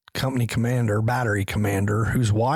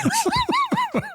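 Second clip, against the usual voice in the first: high laugh burst. Real, not acted. Laughing again at story.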